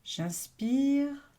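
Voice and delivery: meditative inhale cue, lifted; breathing-in cue. Real, not acted. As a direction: voice lifts and swells on the in-breath.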